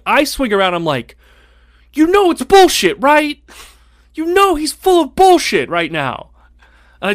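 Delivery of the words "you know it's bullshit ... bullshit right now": put-on high, loud exasperated yell at the girl; retold shout. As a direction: throw it at her, pitched up and loud. Shouting at classmate in retold scene.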